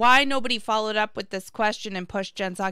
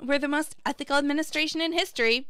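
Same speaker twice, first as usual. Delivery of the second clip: higher, breezy brush-off; dismissive Psaki; 6 semitones higher, faster than usual. Retold or imitated. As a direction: wave the question away, smug. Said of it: "And Psaki's like".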